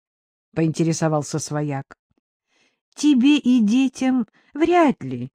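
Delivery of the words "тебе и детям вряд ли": usual speaking voice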